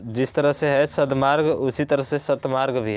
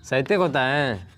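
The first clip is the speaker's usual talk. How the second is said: usual speaking voice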